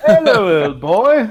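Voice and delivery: high, loud mock English airman; hammed-up English officer greeting. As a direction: play a hearty comic English airman, laughing. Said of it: "those two English airmen".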